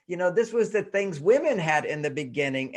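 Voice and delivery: ordinary talk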